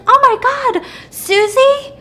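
high, loud excited squeal; gushing surprise. Retold or imitated. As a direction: squeal with delight at an old friend. Old coworker recognizes her.

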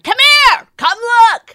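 high, full-voiced mock calling out; yelling. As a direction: imitate someone yelling from out on the deck. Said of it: "quit yelling".

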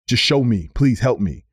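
ordinary delivery

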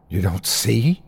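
mildly let-down question; a touch of disappointment; disappointed. A narrator's reading. Terry from further away, disappointed.

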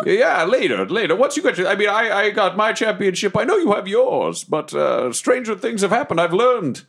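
lilting, put-on date voice; theatrical suitor, evasive. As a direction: stumble grandly through an awkward excuse. Plays Deathwolf on a date.

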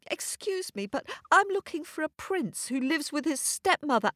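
put-on a frightened girl stammering her question; stuttering, shivering. Cold, scared girl asking the North Wind, stuttering. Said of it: "she stuttered, shivering".

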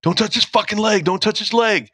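high, urgent cry not to touch the leg; frantic yelling. Retold or imitated. Brian yelling at the team not to touch the leg, panicked high yell. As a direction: yell in panic to keep hands off. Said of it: "I could hear him going".

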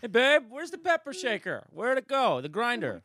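high, loud domestic cutesy voice; wife voice. Shown as a demonstration. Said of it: "That was the pepper grinder voice".

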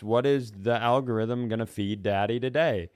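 ordinary talk, nothing marked

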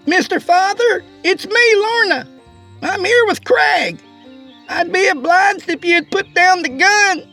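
very high, sassy woman's call; shouting to the cabin. A narrator's reading. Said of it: "Lorna shouted in the cabin's direction again".

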